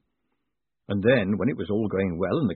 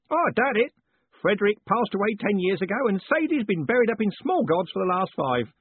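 cheerful bellow; 10 semitones higher than usual. A narrator's reading. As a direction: bellow the sad news cheerfully. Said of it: "bellowed cheerfully".

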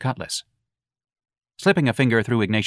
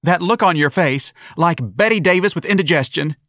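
higher, louder teasing voice; campy young man; 9 semitones higher, 5 dB louder than usual. Acted. Young man mocking Ignatius's face, high and campy. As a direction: camp it up mockingly.

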